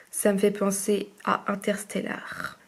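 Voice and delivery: ordinary talk, nothing marked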